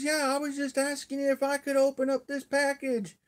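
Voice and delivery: mom calling back, high-pitched; high, sing-out reply; imitated hollering. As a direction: mimic Ma's high holler from another room. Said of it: "I hear her hollering about something".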